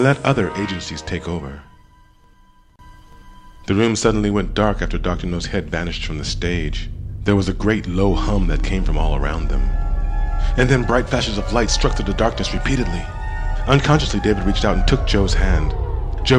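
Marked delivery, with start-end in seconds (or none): none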